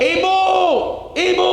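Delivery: teriakan melengking tinggi dan keras; teriakan memanggil ibu. Retold or imitated. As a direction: berteriak memanggil ibu dengan panik. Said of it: "Dia teriak". (anak berteriak memanggil ibunya dengan suara keras)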